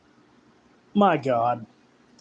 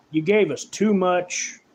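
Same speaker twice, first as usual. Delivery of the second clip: usual speaking voice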